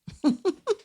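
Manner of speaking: short high giggle; quick amused high laugh. Real, not acted. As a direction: break into a light laugh at herself. Laughs at her own example.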